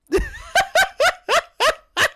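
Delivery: high, helpless cackle; real laughing fit. Real, not acted. Streamer cracking up at the cringe messages, high laughter. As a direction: burst into a long, high laugh. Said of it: "now I'm laughing".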